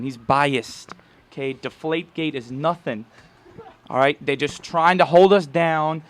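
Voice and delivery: loud, brash Boston sports-fan voice; Boston accent. Retold or imitated. A fan defending the Patriots, in a loud Boston accent. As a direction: rant like a die-hard Boston fan. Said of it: "Can I do a good Boston accent".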